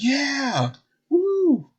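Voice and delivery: high cheer breaking into a whoop; roaring crowd. Acted. Crowd answering the host on stage, high roaring cheer. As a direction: roar back like a thrilled audience. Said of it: "roar again".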